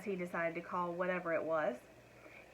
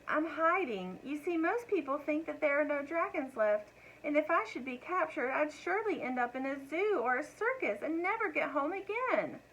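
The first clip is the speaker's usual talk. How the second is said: high, sweet little dragon voice; a small dragon explaining himself to the cows; baby dragon; 7 semitones higher than usual. A narrator's reading. Baby dragon explains his fear.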